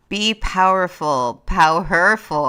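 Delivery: loud, slow and commanding; powerful voice. Shown as a demonstration. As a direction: boom it out with full power. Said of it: "Can you talk like this?".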